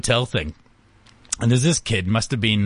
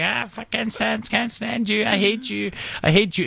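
mock screaming kid; 8 semitones higher than usual. Retelling a kid's tantrum at the hotel, higher mock shriek. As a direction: imitate a tantrum child shrieking at a parent. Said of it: "screaming kid who was like".

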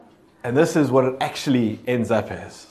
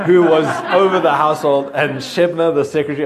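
reading aloud while giggling at herself; laughing through reading; 5 semitones higher, 6 dB louder than usual. Real, not acted. Reading scripture to the group, laughing at stumbling. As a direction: laugh through the names, a bit embarrassed. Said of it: "I didn't read this out loud".

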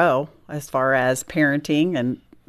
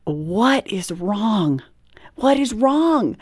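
slow and rising into higher panic; panicked; 5 semitones higher, much slower than usual. Retold or imitated. Mother asking her sobbing daughter what's wrong, panicked. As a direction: ask again and again, panic climbing.

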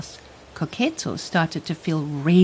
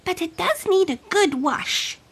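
ordinary delivery

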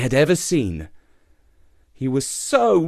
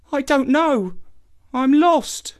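put-on much higher, mournful young voice; doleful; 13 semitones higher, slower than usual. A narrator's reading. Lost youth answers the old lion dolefully. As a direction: say it hopelessly. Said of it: "as dolefully as before".

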